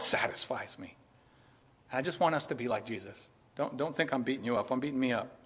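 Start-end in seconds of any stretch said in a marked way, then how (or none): none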